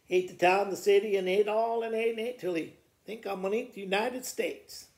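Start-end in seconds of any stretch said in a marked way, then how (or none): none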